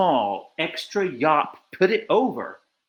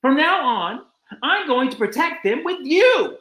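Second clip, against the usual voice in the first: a hint of a kangaroo, rising higher; 10 semitones higher than usual. A narrator's reading. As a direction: promise it, pitched up. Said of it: "said the big kangaroo".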